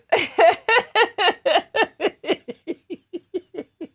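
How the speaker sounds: long, high giggling fit; helpless laughter. Real, not acted. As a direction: laugh till it trails away. Cracking up at herself.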